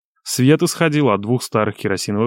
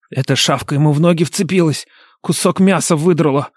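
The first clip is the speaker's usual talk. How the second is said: higher, harsh fearless brute; rasping, remorseless second brother; grating voice; 6 semitones higher than usual. A narrator's reading. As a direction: grate it out without any regret. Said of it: "а скорее скрежетал".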